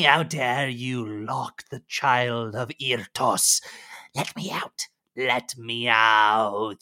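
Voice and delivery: hissing, rasping lizard voice that swells to wild shouts; a cold, furious captive beast begging to be let out; raging lizardfolk prisoner, pleading for heat; angry trapped lizard creature. Acted. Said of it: "clearly angry and irate, cursing".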